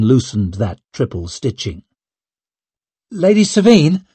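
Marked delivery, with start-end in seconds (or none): 3.1-4.0 a worried maid calling her lady, a little higher; concerned voice. A narrator's reading. Maid calling after her lady, concerned. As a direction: call her name with concern. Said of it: "came Zuri's concerned voice".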